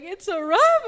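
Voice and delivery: high, loud cheer; excited shout. Retold or imitated. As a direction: shout it back with joy. Speaker echoing the celebration.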